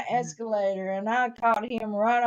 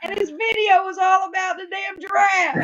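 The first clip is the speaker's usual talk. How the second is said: exasperated shout; 8 semitones higher, 4 dB louder than usual. Real, not acted.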